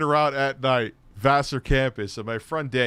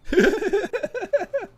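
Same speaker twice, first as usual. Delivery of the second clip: high squealing laugh; wheezing laugh. Real, not acted. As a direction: burst into a helpless high laugh. Friend's toilet story punchline.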